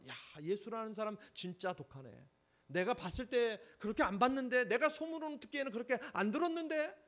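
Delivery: high, loud offended complaint; indignant outburst. Retold or imitated. Preacher voicing the insulted woman complaining about Jesus, rising indignant complaint. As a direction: sound stung and offended, voice rising. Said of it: "화내고 떠납니다".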